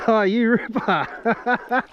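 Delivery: slow, high, stretched delight; elated drawn-out cheer. Real, not acted. Big fish hooked, elated.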